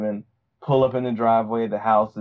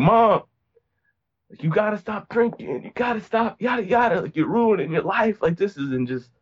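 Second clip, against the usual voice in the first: put-on much higher, pleading teen-sister voice; imitating pleading sister; 10 semitones higher than usual. Sister begging drunk mom to stop drinking, high and pleading. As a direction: beg her to put down the bottle.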